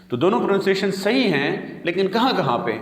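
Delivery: ordinary delivery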